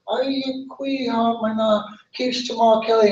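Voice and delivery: a small bird timidly greeting; little bird's greeting. Acted. Bird greets tan oak.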